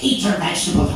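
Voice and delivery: put-on high Irish-accented mum's order; Irish mother. Irish mother tells child to eat, high Irish accent. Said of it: "I'm not the only one here with a cool accent".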